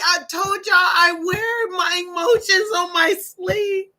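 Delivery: real tearful, sobbing delivery; openly emotional cry. Real, not acted. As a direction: talk through the tears. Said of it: "I'm a cry baby".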